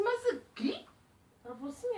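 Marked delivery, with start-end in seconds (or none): 0.0-0.9 surprised. Retold or imitated. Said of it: "Aí eu falei assim".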